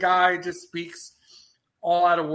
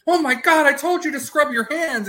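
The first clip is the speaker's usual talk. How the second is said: high, loud staged outburst; mock scolding; 9 semitones higher, 5 dB louder than usual. Covering for a hidden friend in film quote, loud mock scolding. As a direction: scold him loudly in feigned shock. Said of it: "and so he's like".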